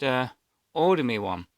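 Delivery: ordinary talk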